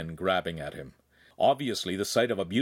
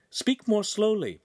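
high, slow instruction; urging calm; 12 semitones higher than usual. A narrator's reading. Friend slowing the frantic speaker, high deliberate urging. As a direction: request it with deliberate, drawn-out words.

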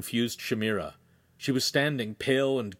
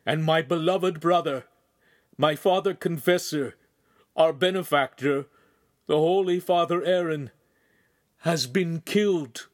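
anguished announcement, pitched up, phrase by phrase; grieving, raised voice with heavy pauses; struggling to speak; 5 semitones higher, 4 dB louder than usual. A narrator's reading. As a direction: get it out in halting pieces. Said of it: "was able to get out".

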